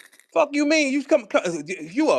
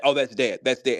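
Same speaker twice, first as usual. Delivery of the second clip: much lower mock robot voice; mimicking robotic talker; 10 semitones lower than usual. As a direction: drop low to mimic the machine-like talker. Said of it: "Who was that?".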